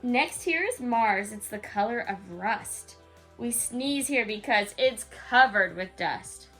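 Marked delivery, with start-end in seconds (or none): none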